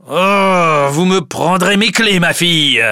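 high, loud, outraged roar; angry old father. A narrator's reading. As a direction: roar indignantly at your daughter. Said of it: "dit-il avec colère". (vieux père en colère, voix forte)

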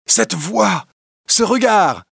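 stunned recognition; amazed. A narrator's reading. Little John recognizing Will.

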